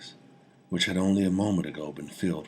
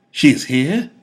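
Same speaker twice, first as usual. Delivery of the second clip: high, loud, anxious question; jumpy and startled, glancing round; nervous vizier; 9 semitones higher, 13 dB louder than usual. A narrator's reading. Vizier nervously asks the king if she has come. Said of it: "in a nervous manner".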